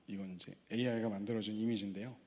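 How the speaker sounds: ordinary talk, nothing marked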